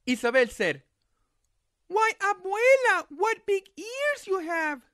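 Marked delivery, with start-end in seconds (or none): 1.9-4.8 put-on high, slow wondering girl; high slow curious girl. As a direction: slowly marvel at the eyes. Girl's voice.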